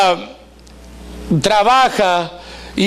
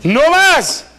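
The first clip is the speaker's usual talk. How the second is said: much higher and louder, fast; defiant shout; 10 semitones higher, 4 dB louder than usual. Retold or imitated. Women fed up with weight, shouting a defiant vow. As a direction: declare it fed up.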